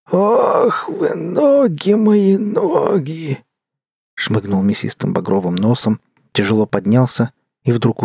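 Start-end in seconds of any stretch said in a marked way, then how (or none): 0.1-3.4 slow, high, crying moan; groaning. A narrator's reading. The tramp climbing out of the bin, moaning slowly and high. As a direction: wail over aching legs. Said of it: "простонал".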